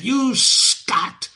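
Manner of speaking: high-pitched furious carter's shout; mad shouting driver. Acted. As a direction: bellow at the stuck horse like a man gone mad. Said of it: "lashed and shouted as if mad".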